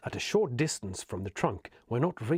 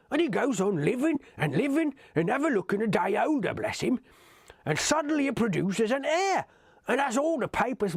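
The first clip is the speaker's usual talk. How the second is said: higher, chatty rustic hobbit voice; gossipy old hobbit; 6 semitones higher than usual. Acted. Old hobbit gossiping about the Bagginses, chatty and high. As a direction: gossip like a village gaffer over his ale.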